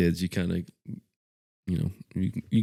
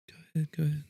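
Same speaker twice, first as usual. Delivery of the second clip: soft, raised polite urging; truck driver waving on; 8 semitones higher, 7 dB quieter, much faster than usual. Retold or imitated. Truck driver to two ladies, soft polite urging. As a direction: quietly insist in a higher voice. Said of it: "the truck was like".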